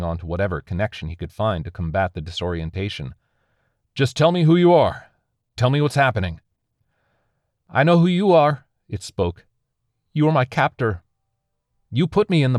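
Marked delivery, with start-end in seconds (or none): 3.9-6.4 high, loud plea; urgent demand. A narrator's reading. Disoriented survivor begs the unseen voice, urgent demand. 7.7-8.7 high, loud entity; raised accusing voice. A narrator's reading. Alien recognises its captor, raised accusation.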